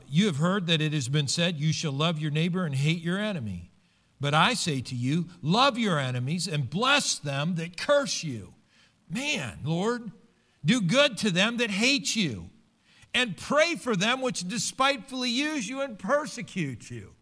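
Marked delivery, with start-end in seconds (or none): 0.1-8.5 loud, emphatic Bible reading; preached scripture reading. Acted. Preacher reading Jesus' command to love enemies, loud and emphatic. Reads scripture aloud to congregation. 10.6-17.1 high, loud scripture proclamation; preached scripture reading. Acted. Preacher reading Jesus' hard command to the church, high and forceful. Reads scripture aloud to congregation.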